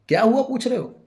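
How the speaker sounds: very high, loud indignant cry; incredulous outburst. A narrator's reading. Wife outraged at husband's question, loud incredulous shout. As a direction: explode in disbelief.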